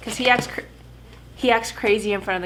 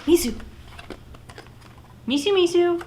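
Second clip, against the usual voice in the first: high sing-song call to a puppy; calling the dog; 12 semitones higher than usual. Real, not acted. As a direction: coax the dog over in a high voice.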